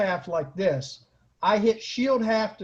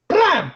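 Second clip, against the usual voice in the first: mock blam; 11 semitones higher, 7 dB louder than usual. Retelling a battle hit.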